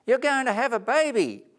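high, loud delighted shout; excited cry. Acted. Tree cries out with joy to Gwen. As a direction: burst out in joy at the news. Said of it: "cried Tina".

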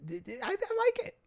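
stuttering then high eager voice; mock regulation fan. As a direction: play a gushing fan of regulation.

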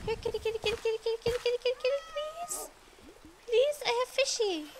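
high sing-song kitty call; calling a cat. Real, not acted. Calling cats with fish.

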